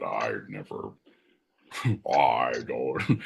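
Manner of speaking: lampooning the actor's famous deep voice, breaking into a laugh; James Earl Jones. Retold or imitated. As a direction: drop way down into a rumbling bass voice. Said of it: "How does he sound, John?".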